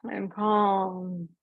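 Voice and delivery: a soft reset mid-meeting; quiet calm affirmation. Shown as a demonstration.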